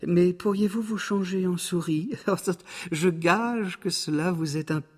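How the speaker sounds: whispered oh-oh, then high cat voice; high, teasing cat with a chuckle; wily cat. Acted. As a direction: feign a fright, then dare him in high pitch. Cat feigns fright, dares ogre.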